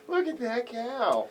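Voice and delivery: high, slow, drawn-out mock yokel; mock drawl. Cohost mocking the host's cow story in a slow, high voice. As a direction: drag it out like a farm hand admiring livestock.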